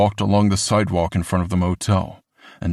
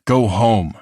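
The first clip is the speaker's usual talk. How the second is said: louder stern order; firm order; 3 semitones higher, 5 dB louder than usual. A narrator's reading. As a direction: send them off firmly. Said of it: "he ordered".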